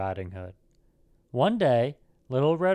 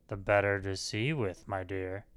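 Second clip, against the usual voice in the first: lightly voiced wolf, lower, softening to the end; wolf as grandmother; 4 semitones lower, 6 dB quieter than usual. A narrator's reading. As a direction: answer low, letting the last words sink softly. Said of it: "said the wolf".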